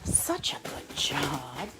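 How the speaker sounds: soft slow whisper to the bird; hushed encouragement to a nervous raven; whispered praise. Real, not acted. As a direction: breathe the praise, barely voiced. Softly praising the bird again.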